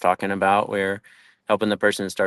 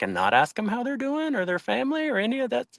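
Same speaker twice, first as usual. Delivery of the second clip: high, soft disbelieving question; incredulous question; 13 semitones higher, 6 dB quieter than usual. Retold or imitated. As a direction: ask in baffled surprise. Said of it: "And I was like".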